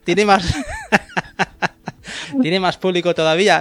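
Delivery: laughing. Real, not acted. Laughing while joking about name.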